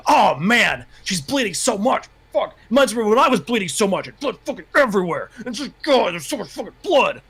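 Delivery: high, hammed-up freak-out; cursing inner monologue. Mocking a fanfic hero's sweary inner monologue, hammed-up freak-out. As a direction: overwrought mock panic, swooping up and down. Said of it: "his inner monologue was, like, all the curse words".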